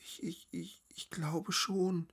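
stammering, high and very hushed; frightened whisper. A narrator's reading. Terrified woman barely reassures her friend, stammering whisper. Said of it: "flüsterte Lazaralen".

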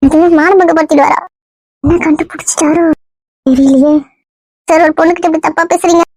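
a lightly put-on girl's voice, hurt and coy; woman's voice. Disguised man plays the girl wooed by the husband. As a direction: play the wronged young woman, only lightly feminine. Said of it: "இன்னும் பொம்பளை குரல்ல தான் பேசுற".

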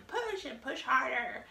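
half-hearted imitation of yelling at someone; mock yell. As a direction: pretend to yell encouragement without full volume. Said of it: "better than just yelling".

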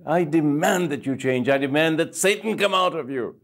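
high, loud commanding voice; commanding demand. Retold or imitated. Guru orders Satan out of the gunman, forceful command. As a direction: cast the demon out with force.

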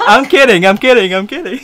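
high, loud, excited protest; loud and high, about to laugh; hurried loud reassurance. Real, not acted. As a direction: blurt it out over the prank's fallout. Reassuring a startled stranger after prank.

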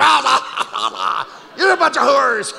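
mock Canadian preacher. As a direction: ham up the guest shouting at the crowd. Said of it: "this guy from Canada came out and said".

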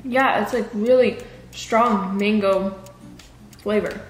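talking between bites; chewing while talking. Real, not acted. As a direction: mouth partly full. Seen 0: Woman's jaw and lips work food while talking, then she drinks water.

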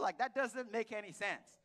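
usual speaking voice

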